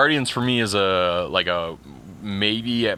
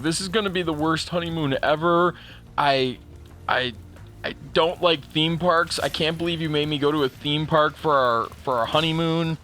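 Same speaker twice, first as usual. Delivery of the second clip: high, whiny mock-upset complaint; a touch of teary protest, pitched high; upset wife; 7 semitones higher than usual. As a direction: imitate his tearful wife whining about the theme park. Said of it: "And she's like".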